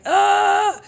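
high, loud strained groan; exasperated groan. Retold or imitated. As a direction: let out a loud fed-up groan. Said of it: "because I was like".

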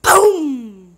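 loud, drawn-out boom; explosion noise. Pretend. Gamer mimics the bomb going off, loud boom. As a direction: burst out with a booming explosion noise.

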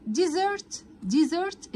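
ديزرت with the s said as a buzzing z. Shown as a demonstration. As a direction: say ديزرت with z not s. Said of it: "الاس هنا بتتنطق كأنها زد". (ديزرت بصوت الزاي)

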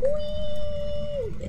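high, drawn-out squeal of fun; gleeful whee. Real, not acted. Dancing for the camera.

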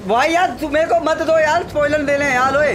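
loud, high, pleading protest; loud begging. Real, not acted. Friend begging not to get spoilers, loud high plea. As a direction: beg him loudly to stop the spoiler.

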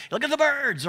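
high-pitched sudden distracted exclamation; distracted excitement. Preacher picturing an easily distracted Jesus spotting birds, excited. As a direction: blurt it out, attention caught by a bird. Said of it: "Jesus has A D H D".

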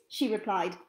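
ordinary delivery

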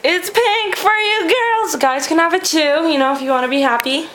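high, loud cheery pitch; bubbly sales pitch. Acted. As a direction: sell it like a cheerleader.

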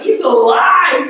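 excited exclaim. Retold or imitated. Disciples telling each other Jesus lives, excited. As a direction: burst out with the news.

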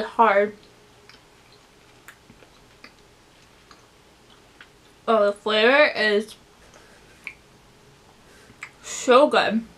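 talking between chews; chewing while talking. Real, not acted. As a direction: mouth partly full of candy. Seen 0: Puts candy in mouth at -9; lips pursed, chewing while talking.

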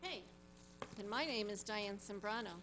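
normal talking voice

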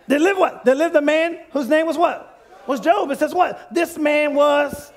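high-pitched, insistent call to the congregation; forceful preaching. Real, not acted. Preacher urges congregation to read aloud, forceful preaching. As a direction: push them to read along with you.

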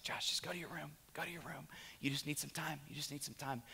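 very soft, near-whispered imitation of a wife; hushed urging. Wife sending husband to his room, hushed urging. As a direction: gently hush him away, barely voiced.

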